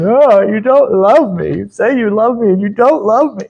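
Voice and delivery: lightly whiny pleading lover; a hint of tearful begging; mock crying and begging. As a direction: whine it like a half-hearted sob. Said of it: "crying and begging".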